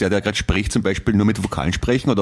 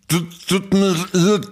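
much higher, very slow, strange put-on voice; mock vowel ban voice; 10 semitones higher, slower than usual. Joking with his co-host about a vowel ban, much higher put-on voice. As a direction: stretch it out high and odd.

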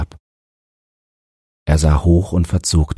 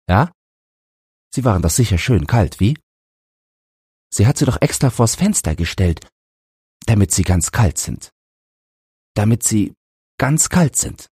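higher-pitched, lightly voiced aggrieved boy; sick son insisting; 6 semitones higher than usual. A narrator's reading. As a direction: press the father with the reproach. Sick boy presses his father. (kranker Junge, vorwurfsvoll drängend)

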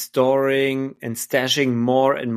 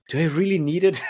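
retold thought breaking into laughter, higher; amused self-questioning; 6 semitones higher, faster than usual. Recalling finding the stored boxes, amused self-questioning. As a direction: quote your own doubt with a laugh.

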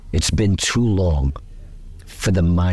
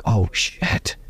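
hushed stunned whisper, almost no voice; awed whisper. Retold or imitated. Imagined listeners reacting to the solo, in a hushed awed whisper. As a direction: breathe it out like you've just heard something amazing. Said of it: "people went like".

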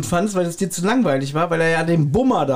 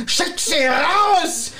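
angry uncle shout; 12 semitones higher, much slower than usual. Retold or imitated. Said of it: "dann kommt ja wieder Onkel Quentin raus".

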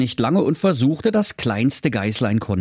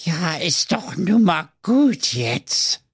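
slow, higher exasperated voice; weary lifeguard; 8 semitones higher, slower than usual. Acted. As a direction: sigh it out, fed up. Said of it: "sprach dieser".

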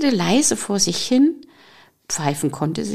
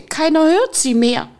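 plain reading voice

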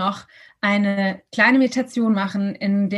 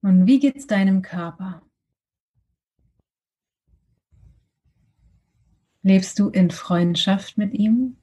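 guided meditation; 3 semitones lower than usual. Acted.

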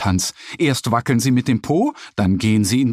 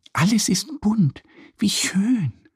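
much higher, whispered wonder; whispered thought; 9 semitones higher than usual. A narrator's reading. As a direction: breathe it in amazement. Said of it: "denkt Annie".

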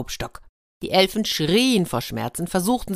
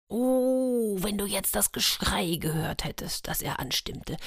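conspiratorial whisper; 5 semitones higher, 6 dB quieter than usual. A narrator's reading. Narrator aside to listener.

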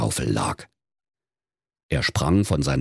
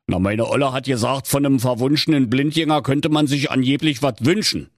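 higher-pitched sheepish husband; henpecked Berliner, voice pitched up; husband explaining; 9 semitones higher than usual. Acted. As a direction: pass on the wife's demand.

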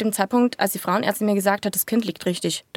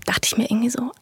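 inner thought; 2 semitones higher than usual. Retold or imitated. Inner thought during caesarean.

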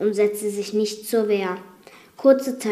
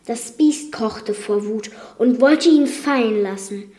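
plain reading voice

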